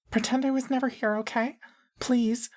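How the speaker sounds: put-on very high, pleading child voice; high-pitched girl. A narrator's reading. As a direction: beg in a small girl's high pitch. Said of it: "and high-pitched".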